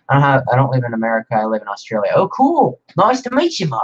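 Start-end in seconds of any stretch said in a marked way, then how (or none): 2.1-3.8 a high, hammed-up Australian twang; an Aussie greeting pitched well up and played for laughs; bad Australian accent. Aussie greeting to a viewer from Australia, in a hammed-up Australian accent. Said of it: "That was probably a terrible accent".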